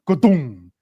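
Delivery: loud, booming "ga-doom"; imitated machine bang. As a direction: mimic a shotgun-shell machine firing. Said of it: "and it goes".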